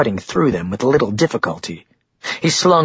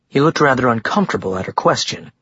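ordinary delivery

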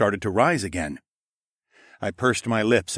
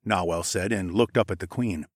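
ordinary delivery, nothing marked

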